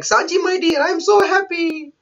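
high-pitched lovestruck bride; put-on happy sweetheart voice; Pudding. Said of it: "twisted personality of Pudding".

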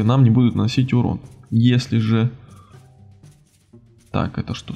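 ordinary talk

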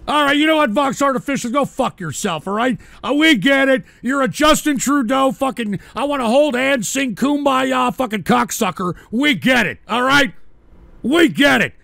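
loud, heated berating of a viewer; angry rant. Real, not acted. Host berating a Canadian donor, loud angry rant.

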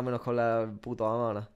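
normal talking voice

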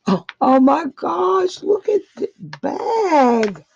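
high, loud delighted squeal over a gift; thrilled squeal. Real, not acted. Crafter opening a gift bag from Janice, squealing with delight. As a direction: gush with real delight.

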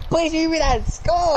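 pleading. Real, not acted. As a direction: beg the game for it. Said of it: "repeated pleading".

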